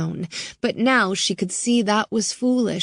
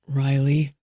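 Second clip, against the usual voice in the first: a lightly lower, tense man's voice; deep, tense; 3 semitones lower than usual. Acted. Bowman answering Riley's call, lightly deep and tense. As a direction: answer sharp and guarded. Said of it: "Bowman's deep voice was tense, cutting".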